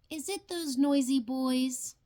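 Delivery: ordinary delivery, nothing marked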